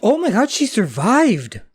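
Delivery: high-pitched astonished exclamation; real surprise. Real, not acted. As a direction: blurt it out in amazement. Reacting to a surprise reveal.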